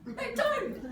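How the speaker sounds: high, loud, laughing insistence; emphatic denial. Real, not acted. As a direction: burst out with the obvious answer. Emphatic repeat of own answer.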